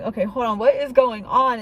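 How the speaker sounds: sudden loud surprise; startled outburst. Retold or imitated. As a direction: blurt it out, caught off guard. Hearing a second message.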